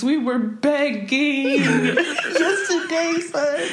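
high, drawn-out words breaking into laughter; laughing. Real, not acted. Friend admitting to begging online, laughing. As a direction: crack up while stretching it out slowly. Cracking up over own begging.

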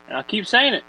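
a hint of Southern; Southern drawl slipping in. Real, not acted. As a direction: let Southern creep into the line. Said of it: "I don't know why I went Southern there".

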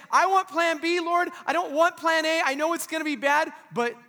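loud, high pleading prayer; crying out to God. Acted. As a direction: cry it out to the Father. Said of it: "he cried out to God".

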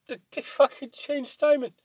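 high-pitched exaggerated complaint; mock outrage. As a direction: whine it like a betrayed fan. Exaggerated outrage about Simon.

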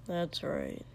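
low and flat, no feeling in it; low, emotionless. A narrator's reading. Katsuki to Shoto in the hallway, low and emotionless. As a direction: hold every feeling back, low. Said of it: "tone low and emotionless".

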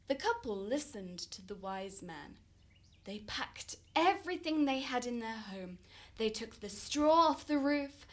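ordinary delivery

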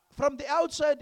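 ordinary delivery

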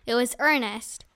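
ordinary delivery, nothing marked

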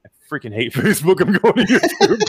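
quoting him through laughter; giggling while mimicking his rant; laughing quote. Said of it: "he's like".

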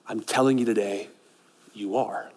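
soft, low, near-whispered assurance; hushed assurance. Real, not acted. Pastor quietly assuring doubters they are worthy, hushed. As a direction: drop right down and say it close. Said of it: "quiet personal assurance".

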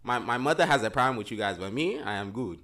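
playful mock African accent; put-on African accent. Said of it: "why are you doing the African accent".

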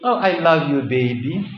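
softly mocking a husband's cheap 'I love you, baby'; a lightly voiced crooning lover; mock sweet talk. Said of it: "in the words of".

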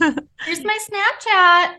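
put-on high, slow cool girl tease. Mock cool girl bio line.

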